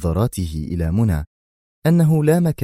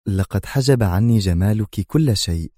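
ordinary delivery

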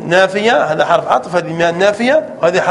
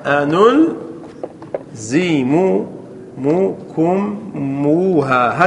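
أنلزمكموها stretched very slowly, syllable by syllable, softer; very slow demo; 4 semitones lower, 4 dB quieter, much slower than usual. Shown as a demonstration. Teacher demonstrating to class, very slow drawn-out أنلزمكموها. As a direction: pull أنلزمكموها apart slowly so every part is heard. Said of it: "هكذا".

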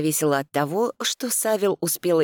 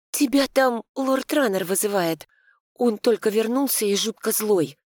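urgent; 6 semitones higher than usual. A narrator's reading. Maid warns urgently.

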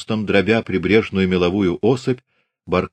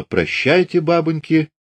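high farewell cry; farewell shout; 8 semitones higher than usual. A narrator's reading. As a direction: call out high across the water. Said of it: "крикнула".

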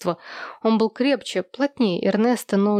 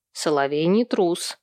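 ordinary delivery

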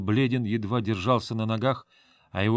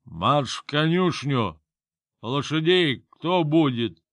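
put-on loud, higher, slow drunk shouting; drunken yell; 4 semitones higher, 4 dB louder, slower than usual. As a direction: bark orders like a drunk master. Drunk master yelling at servant.

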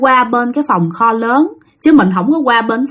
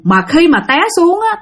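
plain reading voice

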